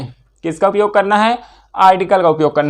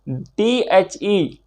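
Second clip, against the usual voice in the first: spelling out slowly; 4 dB quieter, much slower than usual. Shown as a demonstration. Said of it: "spelling वही रहेगा".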